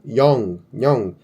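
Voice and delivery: yong held with a nasal ng, falling; Korean syllable demo. Shown as a demonstration. Teacher sounding out yong for the class. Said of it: "nome próprio".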